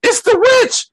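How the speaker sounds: very high shriek, witch rising at the end; terrified scream. Retold or imitated. Billy spotting the witch in the house, high terrified scream. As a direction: scream it like a scared kid pointing. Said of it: "started screaming and shit".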